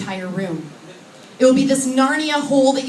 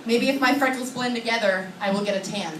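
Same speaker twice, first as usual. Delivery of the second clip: ordinary talk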